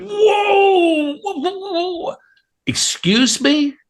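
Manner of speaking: very high, drawn-out mock whoa of shock; hammed-up high-pitched alarm; freaking out. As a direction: imitate someone stunned, voice shooting way up. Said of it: "like, freaking out".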